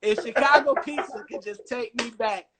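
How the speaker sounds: loud hammed-up plea to pizza; a mock lover's plea; mock pleading. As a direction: beg it to take you back, over the top.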